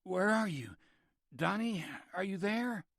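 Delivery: high, anxious call into the dark; patient calling for brother, frightened and high; calling out in panic. A narrator's reading. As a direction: call for your brother, scared and blind. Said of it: "He called out to Donnie".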